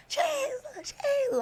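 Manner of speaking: very high imitated wail. On her knees in jail, crying out. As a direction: wail the name, hammed-up. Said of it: "louder and louder and louder".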